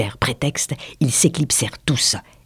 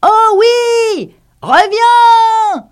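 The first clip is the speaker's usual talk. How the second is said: very high, loud squealed call; piercing, eager shout; exclaiming in chorus; 7 dB louder, much slower than usual. A narrator's reading. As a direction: cry out like a whole flock. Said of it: "s'exclamèrent en chœur".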